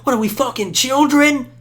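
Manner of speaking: high, loud scolding yell. Retold or imitated. Scolding grown friends like children, a loud high yell. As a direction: scold them like a parent, shouting. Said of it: "so I'm yelling now".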